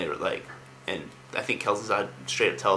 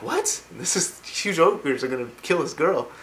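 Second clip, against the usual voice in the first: high-pitched, incredulous protest; mock disbelief; 7 semitones higher, slower than usual. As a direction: imitate baffled soldiers, voice jumping up high. Acted army protesting in disbelief.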